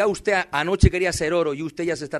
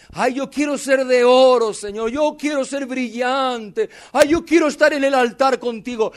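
higher, louder, gushing mock voice; mock naive devotee; 6 semitones higher, 5 dB louder than usual. Pastor mocking believers who want to be gold, gushing and higher. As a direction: parody someone who just wants to shine.

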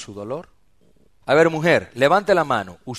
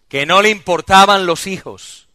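much louder and higher sermon voice; full-force preaching; 8 semitones higher, 13 dB louder than usual. Real, not acted. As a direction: thunder it at the congregation.

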